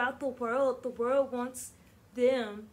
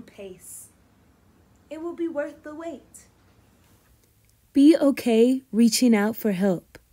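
expressive picture-book read-aloud; reading to children; 11 dB louder than usual. Acted. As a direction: read it warmly to a class. Teacher reads picture book aloud.

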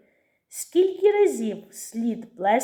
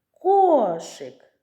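"Кошик" called higher with a long first KOO; calling the word; 4 semitones higher, 4 dB louder than usual. Shown as a demonstration. Teacher and children finding stress, calling "Кошик" with the first syllable stretched. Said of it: "Давайте погукаємо це слово".

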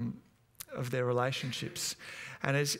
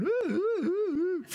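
put-on high, loud child's ooh-ing; repeated high rising ooh calls; loud hooting; 4 dB louder, slower than usual. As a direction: squeal it out like a rowdy little boy. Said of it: "always walking around the house going".

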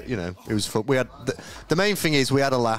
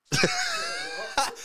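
shrieking laugh; 4 dB quieter than usual. Real, not acted. Reacting to a mock impression.

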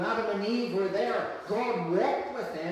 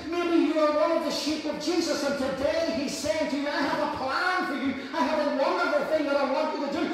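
raised, fast, pleading sermon; impassioned preaching; 3 semitones higher than usual. Acted. Pastor calling straying Christians back, impassioned. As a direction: call the strays home.

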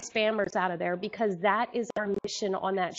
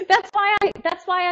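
high, loud, laughing words; a laughing high start; laughing still; 9 semitones higher, 8 dB louder than usual. Real, not acted. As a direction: speak through leftover laughter. Still laughing after the joke.

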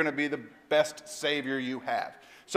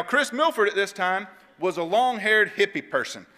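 ordinary talk

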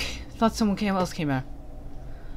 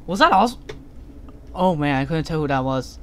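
ordinary delivery

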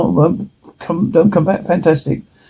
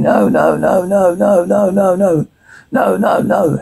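terrified pleading; 3 semitones higher than usual. Acted. Prisoner dragged to experiment.

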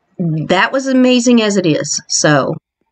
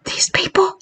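awed whisper; 7 semitones higher, slower than usual. Real, not acted. Whispering at the skeletons.

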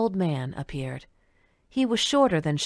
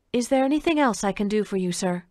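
fast polite question; 4 semitones higher than usual. Acted. Waitress asks politely, quickly.

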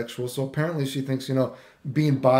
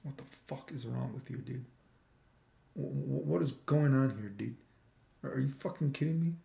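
very soft, stunned questioning; half under the breath, halting in disbelief; hushed disbelief; 9 dB quieter than usual. Retold or imitated. Reacting to her Facebook post, hushed disbelief.

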